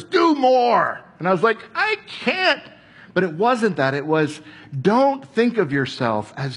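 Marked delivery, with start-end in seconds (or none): 0.0-1.0 louder, higher echo of a demand; a hint of a pushy command; heard demand. What he heard Martha say, a hint of pushy command. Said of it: "All I heard was". 1.8-2.6 much higher helpless cry; overwhelmed. Retold or imitated. Young believer to Martha, high helpless protest.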